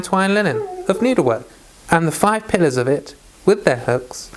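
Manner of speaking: steady, measured Bible reading; scripture reading. A narrator's reading. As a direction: read the passage out evenly, verse by verse. Reads scripture aloud before commenting.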